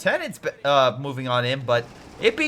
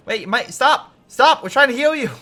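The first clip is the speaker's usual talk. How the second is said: higher, louder frantic call; mock frantic medic; 9 semitones higher, 6 dB louder than usual. Medic yelling after a retreating soldier, frantic. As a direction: plead with the fleeing soldier to stop.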